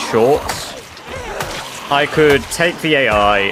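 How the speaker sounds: ordinary talk, nothing marked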